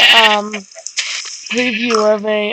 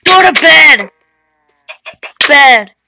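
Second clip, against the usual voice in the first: much louder, barked order; loud command; 3 semitones higher, 9 dB louder than usual. Real, not acted. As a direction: shout it at the pet. Ordering the pet to bed.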